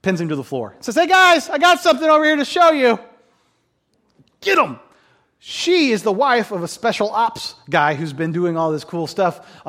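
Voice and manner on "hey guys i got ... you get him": high, loud hollered announcement; playful calling out. Retold or imitated. Preacher playing tent woman showing off her kill, calling out. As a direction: call the guys over, hammed-up. Woman calls men after killing general.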